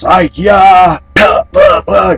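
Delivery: high, loud imitation of the shop lady's cry; startled shout. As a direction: yelp it like a surprised shopkeeper. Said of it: "She come around the corner and goes".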